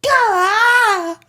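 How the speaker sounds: very high yelp of fright; startled yelp. Retold or imitated. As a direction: shriek as the swarm hits. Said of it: "I was like".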